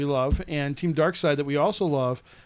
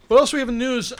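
ordinary delivery

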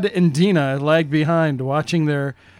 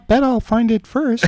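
put-on higher-pitched girl voice; girl's voice; 6 semitones higher than usual. Host reading the sister's teasing line, girl's voice. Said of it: "this is a girl's voice".

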